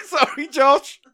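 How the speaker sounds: high laughing apology. Real, not acted. Apologising to Josh for the pun, laughing high. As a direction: apologise while still laughing.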